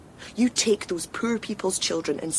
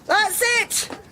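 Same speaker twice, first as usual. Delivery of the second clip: high, loud, fierce yelling; triumphant warning shout; 13 semitones higher, 5 dB louder than usual. Acted. Woman who just fired at Hyde, fierce warning shout. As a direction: chase him off with a shouted threat. Fires gun, drives Hyde off.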